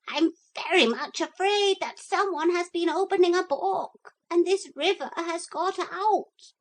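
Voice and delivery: panicked parrot sounding the alarm. Acted. Parrot wakes Philip in alarm, high and frantic.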